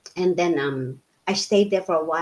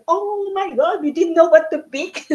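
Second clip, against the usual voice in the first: much higher, excited retold exclamation; overwhelmed delight; 11 semitones higher, 4 dB louder than usual. Recalling her first UK shop's packed shelves, excited and much higher. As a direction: squeal it as if faced with too many choices.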